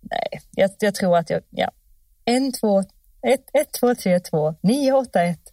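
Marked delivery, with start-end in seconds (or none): 2.2-5.4 put-on number sung out in Stockholm accent. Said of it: "jag sa det på stockholmska".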